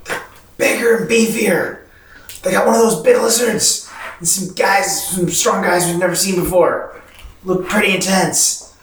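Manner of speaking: whisper-yelling the scouting report; stage whisper. Acted. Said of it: "I'm gonna go".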